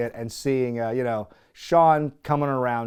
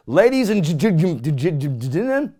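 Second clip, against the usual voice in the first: louder stuttering announcer; mock stutter; 2 semitones higher, 5 dB louder than usual. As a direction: stumble on gentlemen. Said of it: "then he says".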